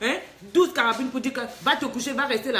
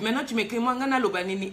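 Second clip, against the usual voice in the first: ordinary delivery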